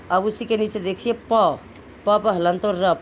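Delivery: usual speaking voice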